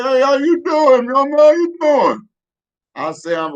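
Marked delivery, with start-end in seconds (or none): 0.0-2.3 Mister Benton greeting, pitched way up; a high, slow put-on old man voice. To the kid at the door, high, slow old man greeting. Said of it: "he didn't sound like that".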